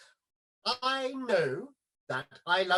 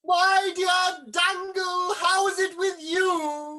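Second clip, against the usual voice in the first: effusive greeting; 7 semitones higher, 3 dB louder, slower than usual. Acted.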